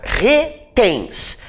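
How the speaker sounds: "Retens" split into Re, then tens; syllable by syllable. Shown as a demonstration. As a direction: sound out "Retens" in two slow pieces. Sounding out syllables for students.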